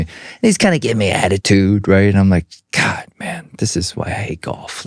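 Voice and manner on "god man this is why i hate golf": whispered, fed-up aside; whispered grumble. Retold or imitated. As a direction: whisper it under your breath in frustration. Muttered complaint on the course.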